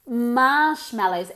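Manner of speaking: "Marshmallows" slowly, leaning on MARSH; stress on first syllable. Shown as a demonstration. Teacher repeats marshmallows for the class, first syllable stressed. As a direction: punch the opening syllable of "Marshmallows". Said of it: "Emphasis on the first syllable".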